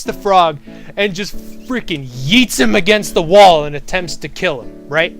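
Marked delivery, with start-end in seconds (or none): none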